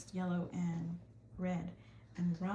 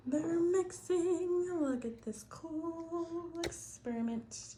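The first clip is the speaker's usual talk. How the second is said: slow amazed; 7 semitones higher, slower than usual. Real, not acted. Awed at fizzing cups.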